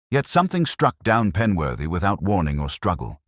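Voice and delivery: plain reading voice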